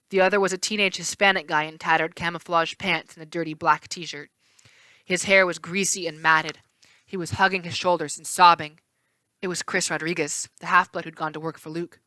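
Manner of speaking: plain reading voice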